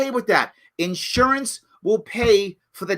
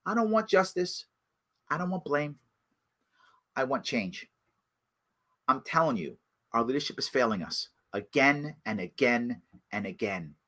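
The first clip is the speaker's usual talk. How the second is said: softer, lower resigned statement; quiet earnest plea; 10 semitones lower, 11 dB quieter than usual. Real, not acted. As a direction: drop down and speak from the heart. Weary plea for change.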